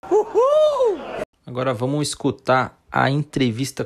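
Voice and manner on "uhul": cheering. Real, not acted. Celebrating leadership at episode end.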